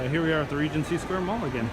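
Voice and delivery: ordinary delivery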